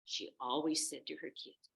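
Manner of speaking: usual speaking voice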